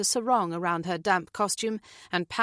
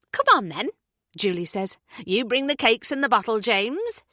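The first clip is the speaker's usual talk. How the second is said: higher, louder, gushing British neighbour; bubbly neighbour Julie; 4 semitones higher, 4 dB louder than usual. Acted. Julie introducing herself to new neighbours, high and gushing. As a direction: breeze in all eager and chirpy.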